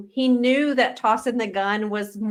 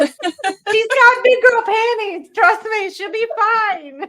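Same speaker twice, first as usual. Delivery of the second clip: very high, louder, giggly teasing; laughing mockery; 12 semitones higher, 5 dB louder, slower than usual. Real, not acted. Co-hosts making fun of the heroine, laughing hard. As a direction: joke through a fit of laughter.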